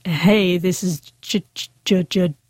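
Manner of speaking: imitating a man blocking repeatedly on his name; John, stuttering. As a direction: retell the greeting with a repeated stutter on the J. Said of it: "stuttering a lot".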